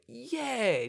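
high, eager mock recruit; mock eagerness. Acted eager recruits answer again.

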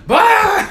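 sudden loud high laughing blurt; explosive laugh bursting out; burst of laughter. Real, not acted. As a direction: crack up out of nowhere. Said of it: "I just started laughing".

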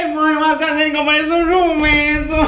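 high voice through laughter; laughing tattle. Retold or imitated. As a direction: call to mom while cracking up. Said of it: "Eu dizia".

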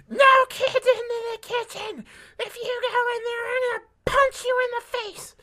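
shrieked way up high, loud and slow; a frantic woman shrieking a threat at kids; screaming. Retold or imitated. Restaurant lady screaming at the kids, high shrieking yell. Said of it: "screamed it right in my face".

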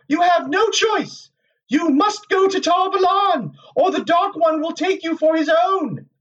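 very high, commanding female character; lashing voice. A narrator's reading. Moiraine's apparition warning Rand he has no choice, lashing voice. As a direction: deliver a high, forceful warning like a lash. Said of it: "her voice was a lash".

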